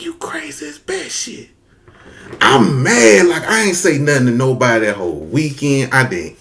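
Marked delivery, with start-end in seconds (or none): 0.0-1.5 high, hushed squeak; aghast whisper. Retold or imitated. Boy answering his grandparents' explanation, aghast whisper. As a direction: whisper it in mock disbelief.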